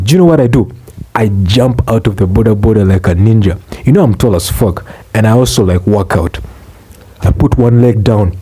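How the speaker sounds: normal talking voice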